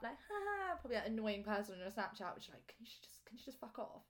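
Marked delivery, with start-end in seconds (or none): 2.3-4.0 whispered aside. Real, not acted. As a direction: whisper under the breath, almost silent. Muttered aside, then says sorry.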